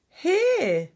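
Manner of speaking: high, loud, triumphant reveal; eager announcement. A narrator's reading. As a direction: proclaim the answer to the family. Father answers the family's chorus of where.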